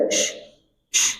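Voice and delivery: Sh hissed hard and wide; demonstrated shin hiss; shin sound demo. Shown as a demonstration. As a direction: push out a strong spreading sh. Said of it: "shin itu bunyinya".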